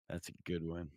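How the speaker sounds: normal talking voice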